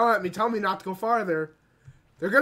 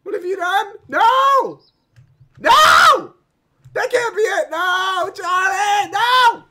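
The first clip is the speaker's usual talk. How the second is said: high, loud wails of denial; hammed-up anguished shouting; distraught screaming; 12 semitones higher, 11 dB louder, slower than usual. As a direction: scream it out in horrified disbelief. Reacts to child being taken.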